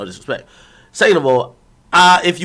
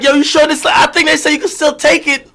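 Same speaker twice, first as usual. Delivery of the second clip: high-pitched mock pleading, a little louder; retold insistent urging; 10 semitones higher, 3 dB louder than usual. Streamer replays pressing the woman about the pill, high-pitched urging. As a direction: urge her in a high, incredulous retelling.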